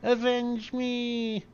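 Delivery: high, slow, hammed-up dying cry; dying plea. Dying Slavo begging Bruce, high and slow. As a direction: stretch out a melodramatic plea.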